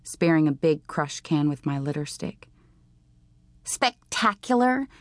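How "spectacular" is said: high, drawn-out, rising incredulous repeat; mocking echo. A narrator's reading. Bug repeating Marilee's word back at her, mocking. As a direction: throw the word back as a scornful question.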